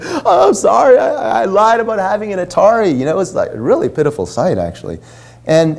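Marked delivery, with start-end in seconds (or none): 0.0-2.9 mock sobbing apology, much higher with a cry at the start; imitated tearful apology. Boy caught lying apologizes to friends, mock tearful cry. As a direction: imitate a crying kid blurting out sorry, high and strained. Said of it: "So through his tears".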